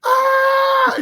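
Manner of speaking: a very high, loud imitated crowd roar. Drafted son imitating family's roar from the house, high cheering shriek. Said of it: "I hear this big roar at my house".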